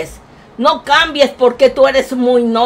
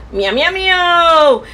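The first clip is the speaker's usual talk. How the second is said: cat meows; 3 semitones higher, much slower than usual. Pretend. Grandma persona meowing to chat.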